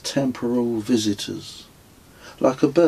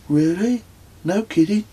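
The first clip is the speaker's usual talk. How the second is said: much higher, wide-eyed disbelief; as if hearing big news; amazed Kez; 10 semitones higher than usual. A narrator's reading. Kez reacts to the knicker story, much higher and amazed.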